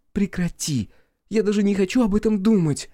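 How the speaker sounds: high, loud distressed protest; upset outcry. Acted. Wife recoils from gruesome talk.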